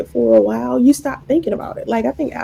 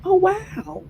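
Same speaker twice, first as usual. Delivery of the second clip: surprised wow; 7 semitones higher than usual. Retold or imitated. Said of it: "I was like".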